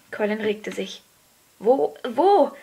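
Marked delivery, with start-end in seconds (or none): 1.6-2.5 high, loud, excited cry; eager call. A narrator's reading. Colin stirs, desperate to see, calling eagerly. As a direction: call it out, bursting to know. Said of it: "rief er".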